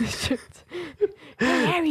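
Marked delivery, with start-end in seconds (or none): 1.4-1.9 high, loud call across the pitch. Pretend. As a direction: shout his name to get his attention. Acting Wood calling to Harry.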